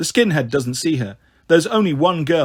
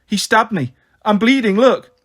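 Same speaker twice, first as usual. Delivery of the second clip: higher, louder alarm; raised, urgent wounded voice; panicked outcry; 6 semitones higher, 4 dB louder than usual. Acted. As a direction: cry out as if badly hurt. Dan claims he was stabbed.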